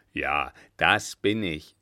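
low, loud, slow self-satisfaction; very proud. A narrator's reading. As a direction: draw it out with pride. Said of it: "sagte der Kutscher sehr stolz". (sehr stolz, tief und gedehnt)

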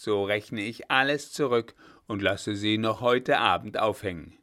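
put-on loud exasperated old marshal giving in; old general loudly giving in to the girl's pleading; outburst. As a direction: burst out, gruff but yielding. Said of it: "bis er endlich losbrach".

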